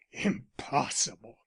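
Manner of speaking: he spits her word back at her; fierce echo. A narrator's reading. Arnold turns on Elsie, slow bitter echo of "Impossible". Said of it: "he echoed".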